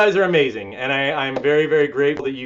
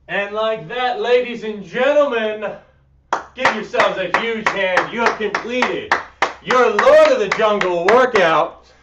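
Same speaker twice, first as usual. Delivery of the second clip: announcer voice; 6 semitones higher than usual. Acted. Coach closing the workout to the viewers like an emcee announcing. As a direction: hype the finish like a ring announcer.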